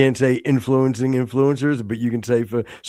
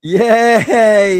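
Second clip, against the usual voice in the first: loud, high cheer; excited cheer; 6 semitones higher, 11 dB louder than usual. Acted. Cheering his cohost's birthday, loud and high. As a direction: whoop it like a party.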